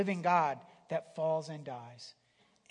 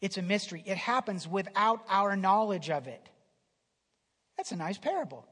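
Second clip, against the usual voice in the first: ordinary delivery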